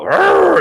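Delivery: high, loud mock battle cry; a hammed-up desert raider war cry; sand people roar. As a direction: bellow like a raiding sand person. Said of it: "And they go".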